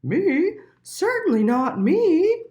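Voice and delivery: high, loud, offended denial; a prim cow taking offence. A narrator's reading. The cow denying it to Little Mole, loud and offended.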